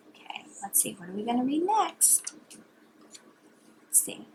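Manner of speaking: soft bedtime whisper. Real, not acted. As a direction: parent whispering while picking next book.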